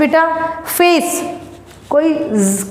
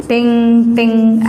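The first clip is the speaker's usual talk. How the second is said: imitated bell ting; 3 semitones lower, much slower than usual. Shown as a demonstration. Teacher shows students the ice cream bell, slow ringing टिंग टिंग. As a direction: chime टिंग टिंग slowly like a small bell. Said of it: "ऐसे करके sound".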